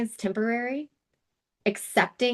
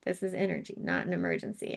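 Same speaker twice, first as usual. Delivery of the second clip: quiet mantra; 5 semitones lower, 10 dB quieter, faster than usual. Shown as a demonstration. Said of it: "If you wanna use that just with your tapping".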